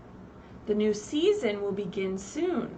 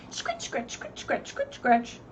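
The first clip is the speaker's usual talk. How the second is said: scratching sound; slower than usual. Acted. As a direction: make the digging noise. Sound effect words in story.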